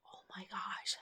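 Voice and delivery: whispered dismay on waking; hushed, airy reaction; breathless whisper. Retold or imitated. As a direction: whisper it under your breath, aghast. Retold groggy waking after short sleep.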